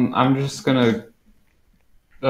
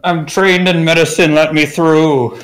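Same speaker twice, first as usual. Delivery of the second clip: calling out; 6 semitones higher, 7 dB louder than usual. Acted. Player bluffs guards loudly.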